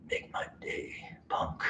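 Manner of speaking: whispered menacing tough guy; a quiet gritty threat like a gunslinger; Clint Eastwood. Retold or imitated. Trainer doing a whispered Clint Eastwood threat to the dog. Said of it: "your little Clint Eastwood".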